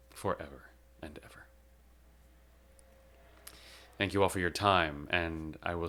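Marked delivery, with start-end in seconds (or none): 0.1-1.5 quick breathy whisper of farewell; a whispered goodbye. Real, not acted. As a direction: breathe it out to her. Tender farewell to grandmother.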